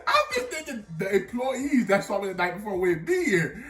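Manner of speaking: high-pitched, laughing disbelief; barely holding back laughter; talking through laughter. Real, not acted. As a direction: squeal it out while cracking up. Laughs at his own foolish plan.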